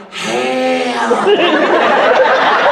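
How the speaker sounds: Bobby Hill whisper yell; half-whispered cry for help. Retold or imitated. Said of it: "I just basically become Bobby Hill".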